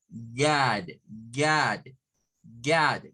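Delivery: low, drawn-out "Yad" with an open O; O with A mouth. Shown as a demonstration. Teacher demonstrates the mouth shape, "Yad" as open A-like O. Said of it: "con nuestra boca en forma de A".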